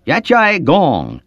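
Yat Chai Gong said slowly and carefully, falling on Chai; teacher slowly modelling Yat Chai Gong for the class, careful Cantonese; Cantonese phrase. A narrator's reading. Missionary teacher drilling students, slow careful Cantonese. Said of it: "our M T C teacher, Sister Tong, said".

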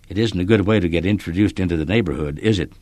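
usual speaking voice

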